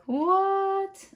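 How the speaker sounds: high squeaky what; a shocked high What; surprised. Retold or imitated. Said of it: "You're like".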